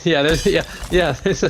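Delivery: giggling through the words; laughing. Real, not acted. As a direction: laugh while answering. Laughing reply to joke.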